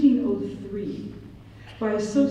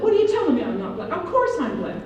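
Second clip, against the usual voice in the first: incredulous; 6 semitones higher, faster than usual. Retold or imitated. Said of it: "I was like".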